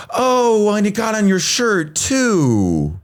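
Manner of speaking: high, loud fake surprise; mock alarmed. Husband pointing at wife's shirt in loud, high fake alarm. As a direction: overplay the shock to cover the chocolate stain. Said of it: "I'm like".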